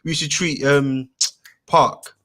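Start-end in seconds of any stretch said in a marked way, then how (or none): none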